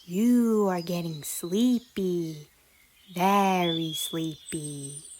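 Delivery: a playful hammed-up hypnotist voice; exaggerated hypnotic tone. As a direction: drone it like a stage hypnotist, hamming it up. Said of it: "speaking in an exaggerated hypnotic tone".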